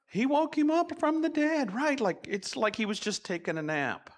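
high-pitched, excited marvelling; amazed excitement. Real, not acted. Children's director marvelling that Lazarus woke from the dead, excited.

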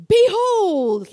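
booming showman's announcement, high and loud; big top announcer proclaiming behold; ringmaster's call. Retold or imitated. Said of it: "like that".

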